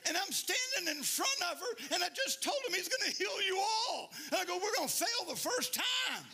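full-force preaching. Real, not acted. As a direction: preach it at the top of your voice with laughter.

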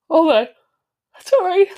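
talking through a big yawn. Real, not acted. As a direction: let the words stretch in a yawn. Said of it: "There I go with my first yawn".

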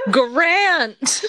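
high, slow, teary laughter; laughing. Real, not acted. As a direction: cry out through giggles. Laughing mock outrage.

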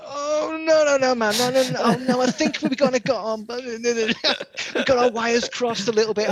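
whiny mock pleading. Caught-out skaters pleading to stay, mock whiny high voice. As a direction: play them begging and squirming.

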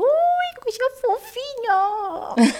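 put-on high cooing over a baby; baby talk. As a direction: coo like seeing a cute baby.

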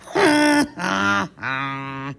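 bright burst of laughter; laughing. Real, not acted. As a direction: laugh out at the joke. Laugh after punchline.

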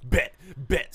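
punchy shouted taunt; mock street challenge. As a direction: imitate a worked-up brawler.

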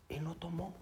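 hushed, confiding whisper. Retold or imitated. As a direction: lean in and whisper it. Confiding the surprising news. (susurrado al pastor en confidencia)

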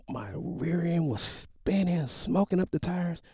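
high-pitched, stretched-out boast; excited retelling. Retold or imitated. Father telling son about smoking tires, excited. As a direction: build the thrill, pitched well up.

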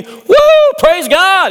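very high, loud whoop of praise; a leper's whoop of praise, high and loud; shouted praise. Acted. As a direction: shout it out to God. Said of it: "He's shouting it".

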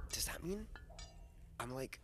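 hushed, hesitant near-whisper. Acted. Kid leans in to the nice goblin to ask, in a hushed voice. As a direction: ask it low and very softly, as a secret. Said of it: "Timothy lowers his voice".

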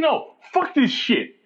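high, drawn-out retold refusal; fed-up outburst. Grill cook refusing his girlfriend's pleas, fed up. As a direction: refuse slowly, voice pitched right up.

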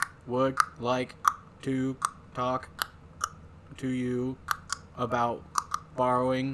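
long pause after every word; pauses between each word. Retold or imitated. Teacher asking about scissors in SpongeBob style, word by word pauses. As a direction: deliver it one word at a time, spaced out. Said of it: "where everybody goes ... in between each word".

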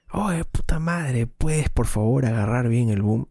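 high-pitched irritated outburst, stretched at the start; retold angry yell. Director yelling at whoever holds the boom, retold angry yell. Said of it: "Y lo grité asado".

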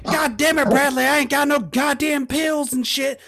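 high country mom voice. Retold or imitated.

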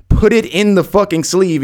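high, exasperated demand; worked-up replay of an order; retold angry order.

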